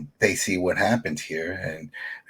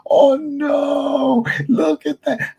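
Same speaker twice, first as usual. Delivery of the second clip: mock alarm; 5 dB louder, much slower than usual. Mock alarm at rising meter.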